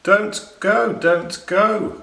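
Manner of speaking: high, loud urgent cry; shrill-free high pleading shout; seagulls crying out. A narrator's reading. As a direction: call out a warning like gulls. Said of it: "cried the seagulls".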